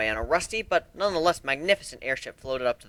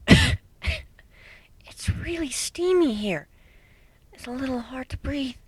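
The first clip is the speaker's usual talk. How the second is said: timid Fluttershy; 13 semitones higher, much slower than usual. Acted. Fluttershy struggling to breathe in the steam, timid and small. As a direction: squeak it out, barely coping with the steam.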